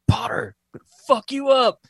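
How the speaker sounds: mock the furious owner bellowing at the dog; hammed-up angry dog owner; pissed yelling at dog. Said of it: "the dude was yelling at his dog".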